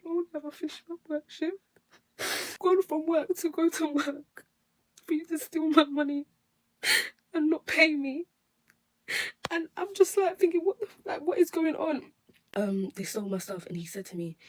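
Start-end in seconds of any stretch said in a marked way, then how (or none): 0.0-1.6 the words crack as she starts to cry; real crying. Real, not acted. Telling viewers about the attack, breaking into real tears. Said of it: "I'm really trying to get my words out smoothly". 2.6-12.1 sobbing much higher and louder, voice cracking; crying it out through the words, no holding back; real crying. Real, not acted. Reliving the attack for viewers, real loud sobbing. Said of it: "upset and emotionally stressed".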